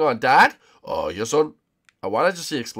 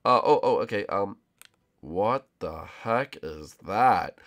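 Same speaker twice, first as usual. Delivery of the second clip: ordinary talk, nothing marked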